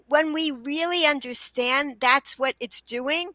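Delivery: usual speaking voice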